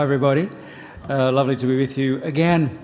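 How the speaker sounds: ordinary delivery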